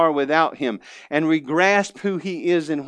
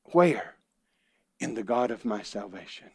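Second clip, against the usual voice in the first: ordinary delivery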